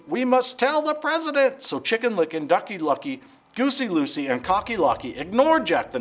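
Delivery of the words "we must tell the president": panicked clucking hen. Acted. Chicken Licken continues.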